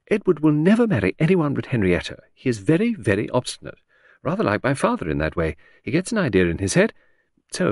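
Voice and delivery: breezy chatter that floats from topic to topic; Lucy, lady of the house. Acted. Chatting to her husband in the garden, airy high lady.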